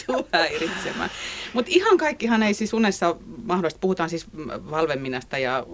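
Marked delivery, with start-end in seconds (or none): 0.0-1.1 half-laughing imitation of a child's shout; retold shout. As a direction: daughter yelling at the tiger at night, laughing mock yell. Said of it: "kun sitä huutoa kuului".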